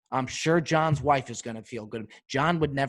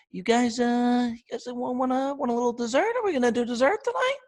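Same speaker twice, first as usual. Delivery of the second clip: much higher, hesitant dessert offer; timid high waiter; 11 semitones higher than usual. Retold or imitated. Waiter nervously asking the table about dessert, high and hesitant. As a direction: squeak it out unsure. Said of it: "Their voice gets all high".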